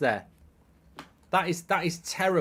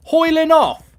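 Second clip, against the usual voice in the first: loud, higher, outraged repeated shouts; furious shouting; 7 semitones higher, 9 dB louder than usual. Real, not acted. Fan furious as the manager takes Højlund off. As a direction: bark it at the screen in outrage.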